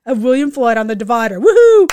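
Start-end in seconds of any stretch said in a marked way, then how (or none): 1.4-1.9 loud, high celebratory whoop; triumphant whoop. Retold or imitated. Celebrating a high five with the officer, triumphant whoop. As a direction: whoop it out in victory.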